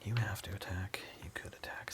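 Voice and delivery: soft unvoiced whisper. Real, not acted. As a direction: whisper it to yourself. Whispering over the game.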